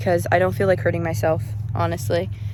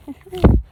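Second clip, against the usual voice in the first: laugh; 10 semitones higher, 6 dB louder than usual. Real, not acted. Laughs at cow after cooing.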